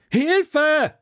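very high, loud cry for help; panicked shriek for help; scream. Acted. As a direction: scream as if drowning. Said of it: "schrie ich".

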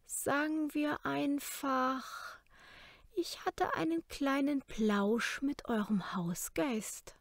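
slow, high, half-whispered sly tone. A narrator's reading. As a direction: savor it, slowly and knowingly. Kara answers smugly.

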